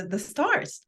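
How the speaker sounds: ordinary talk, nothing marked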